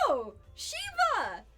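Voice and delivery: high, loud, slow dismay at the escaped pet; sing-song shock, drawn out; comic high alarm, then okay; mock surprised. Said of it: "And I'm like".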